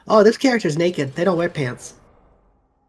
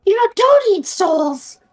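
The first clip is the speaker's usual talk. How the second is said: higher, hammed-up Homer Simpson voice; 12 semitones higher, 3 dB louder, slower than usual. Shown as a demonstration. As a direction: do Homer calling for Marge. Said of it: "Homer Simpson".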